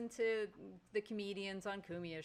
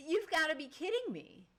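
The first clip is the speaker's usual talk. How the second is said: high, louder incredulous complaint; can't believe it's happening; exasperated disbelief; 10 semitones higher, 6 dB louder than usual. Retold or imitated. Reacting to a man hacking on the treadmill, loud incredulous disbelief.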